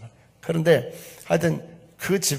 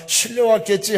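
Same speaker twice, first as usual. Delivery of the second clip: scornful mother; 9 semitones higher, 8 dB louder than usual. Retold or imitated. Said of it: "어머니가 뭐라고 하시는데".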